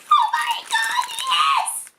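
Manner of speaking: very high, laughing squeal of joy; excited squeal. Real, not acted. Unboxing gift from friend, squeals high with laughing joy. Finds dinosaur stickers, squeals.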